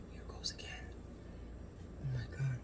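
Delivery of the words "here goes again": soft secretive whisper. Real, not acted. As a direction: hush it like a secret. Another bite, whispered.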